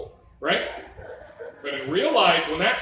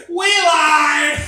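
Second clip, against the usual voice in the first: high cackle breaking into a loud shout of we lied; gleefully spring the trap on the new dad; mock cackling shout; 11 semitones higher, 5 dB louder than usual. Everyone mocking the new father, cackling shout. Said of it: "they're like".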